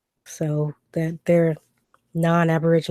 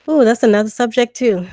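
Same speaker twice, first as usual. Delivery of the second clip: surprised ooh; 5 semitones higher, 6 dB louder than usual. Real, not acted. Reacting to a hard question.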